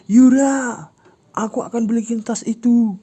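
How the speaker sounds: high, loud shout of her name; desperate shout. Acted. Ujang shouting after Yura, desperate yell. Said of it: "Ujang berteriak".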